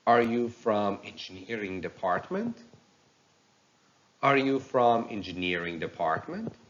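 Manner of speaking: yes-no question climbing at the end of "department"; level question, then an upturn on "department"; rising intonation. Shown as a demonstration. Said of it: "yes-no question with rising intonation".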